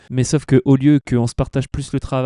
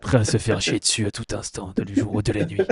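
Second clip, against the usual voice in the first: lower, quieter hero growl; gruff superhero voice; 6 semitones lower, 5 dB quieter than usual. Pretend. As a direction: play the brooding vigilante. Superhero tagline, low and quiet.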